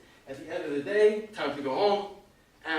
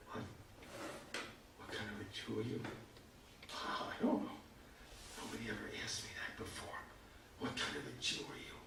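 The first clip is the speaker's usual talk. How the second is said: dumbfounded mumble; 5 semitones lower, 18 dB quieter than usual. Retold or imitated. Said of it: "And I'm like".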